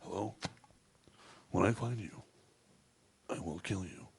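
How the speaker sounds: low, hushed phone threat; quiet menacing impersonation; movie tough-guy impression. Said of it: "working on it a little bit".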